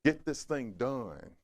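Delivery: slow, one syllable at a time; slow and clipped. Shown as a demonstration. Preacher demonstrating syllable spanking, slow. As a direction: spell it out slowly like a scolding. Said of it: "Shorten the sentence up".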